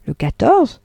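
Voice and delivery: "quatorze" lifted into a puzzled rising question; husband echoing the date in mild surprise; surprised question. A narrator's reading. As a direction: repeat the date back, caught off guard. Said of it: "fit Arnould, en levant les yeux".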